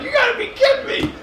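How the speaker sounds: high, laughing you gotta be kidding me; laughing disbelief. Retold or imitated. Telling friends about it, laughing in disbelief. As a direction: crack up in disbelief at the news. Retelling his shocked reaction.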